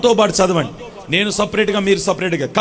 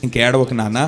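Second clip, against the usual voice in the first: God's tender voice; 8 semitones lower than usual. Retold or imitated. God speaking to his weeping child, low and tender. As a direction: hush a crying child as a loving father. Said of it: "ఈరోజు నీకు మాట ఇచ్చినాడు".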